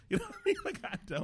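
high laughing voice; cracking up mid-sentence. Real, not acted. Laughing at his own story.

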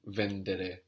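"vendere" stressed on VEN; stress third from end. Shown as a demonstration. Teacher shows the student an exception, stress on VEN in "vendere". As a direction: hit VEN, let "-dere" fall away in "vendere". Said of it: "but not here".

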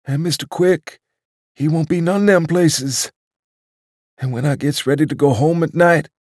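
put-on a doddering old waiter picturing the club without him; old waiter, wistful. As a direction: list each place like a mournful litany. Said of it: "as though telling a ghost story".

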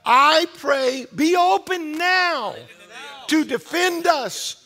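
high, loud, slow imploring prayer; fervent plea. Acted. Pastor voicing the people's plea to God, fervent. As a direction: beg for rescue with full voice.

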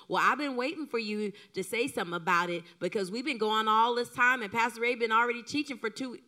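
loud, fast, exasperated nagging; nagging wife. Retold or imitated. Pastor's wife mocking a wife who nags her husband, loud and fast. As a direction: rattle off the complaint like a fed-up wife. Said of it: "Don't do this".

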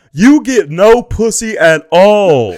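high, loud, slow mock tirade; mock Doctor Phil. Retelling Doctor Phil tearing into a guest, in a high mocking yell. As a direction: ham up a sneering talk-show host.

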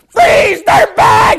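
imitating a full-force, high-pitched scream. Meek recruit kicks the door down, pulls her gun, screams. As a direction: scream the command at the top of your voice. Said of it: "and, like, screams".

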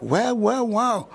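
high-pitched retold wonder; amazed. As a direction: marvel to yourself, pitched well up. Amazed thought at men working.

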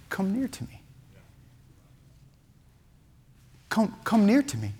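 soft, halting, forgiving call; hushed and gentle, with long pauses; tender invitation. Acted. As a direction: draw them closer softly. Joseph gently calls brothers closer.

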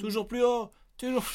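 high, loud game-show host cry; mock TV host hyping the show; Fort Boyard slogan. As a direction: belt it like the show's host.

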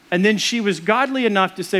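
ordinary delivery

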